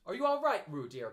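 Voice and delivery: high, loud anxious call. A narrator's reading. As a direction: call out to him in worry. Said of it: "called Kanga anxiously".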